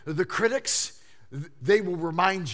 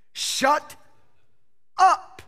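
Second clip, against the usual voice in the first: loud shout; 8 semitones higher, 8 dB louder than usual. Retold or imitated. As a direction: shut up blurted high and loud. Mama's saying, punchline.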